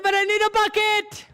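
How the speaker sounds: high, pleading mock whine; whiny insistence. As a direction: beg like a stubborn kid. His insistent reply.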